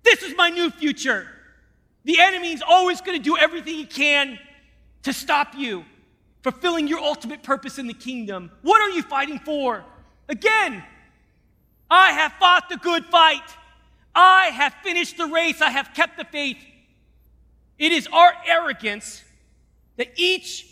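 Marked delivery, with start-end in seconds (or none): 0.0-1.3 louder and higher, declaring; convert's loud vow; ringing declaration. Retold or imitated. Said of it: "and he said". 11.9-16.6 proclaimed scripture. Real, not acted. Repeated scripture, now proclaimed.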